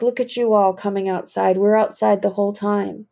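ordinary talk

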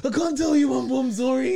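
mock crying. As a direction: blubber that you can't say. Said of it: "and I'm crying".

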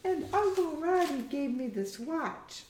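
put-on high, girlish character voice; Hamish, young daughter. Daughter figure's dialogue in story.

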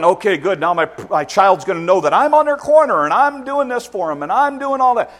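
loud, high self-congratulating; smug parent. Retold or imitated. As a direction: pat yourself on the back. Parent voiced, self-satisfied.

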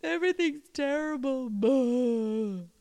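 high, loud mock sobbing; hammed-up crying. Shown as a demonstration. Teacher demonstrates sob quality as a crying student. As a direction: wail it out like a melodramatic weeper. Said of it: "cry for me".

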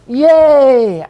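mock cheer. Mock new convert celebrating.

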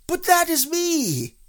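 high, loud astonished cry; astonished bear squeal; very much surprised. A narrator's reading. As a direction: exclaim it slowly, amazed. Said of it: "very much surprised".

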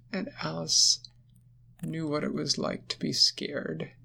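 plain reading voice

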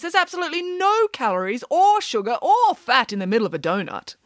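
high-pitched, emphatic nanny voice; assertive nanny. Acted. Nanny justifying donuts to the children, emphatic. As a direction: start high and insistent, settle at the end.

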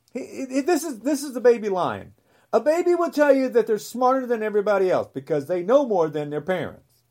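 normal talking voice

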